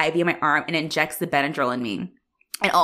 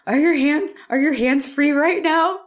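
tiny, high, pleading whimper; little punk; 10 semitones higher, 4 dB louder, slower than usual. Retold or imitated. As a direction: imitate your panicked self begging, high and drawn out. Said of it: "I sounded like that".

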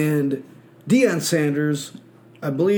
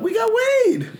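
goofy quote. Retold or imitated. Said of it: "They're like".

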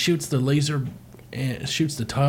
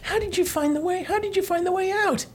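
high cartoon voice. Voicing a character in cartoon.

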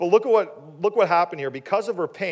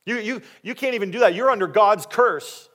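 loud, higher-pitched needling taunt; 5 semitones higher, 8 dB louder than usual. Acted. Rival wife provoking childless Hannah, taunting. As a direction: rub it in, acting out a cruel rival. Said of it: "it would be in a way of".

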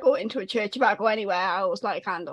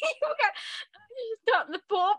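high-pitched, laughing through it; barely getting words out for laughing; 12 semitones higher, 3 dB quieter than usual. Real, not acted. Friend cracking up over the Pope story, laughing. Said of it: "I'm crying".

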